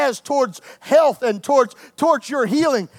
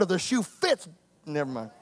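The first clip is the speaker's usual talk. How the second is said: much softer, dropping away; muttered aside; 8 semitones lower, 10 dB quieter than usual. Real, not acted. Preacher mutters a jab, fading to nothing. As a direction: swallow the jab under your breath. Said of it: "aside trailing off".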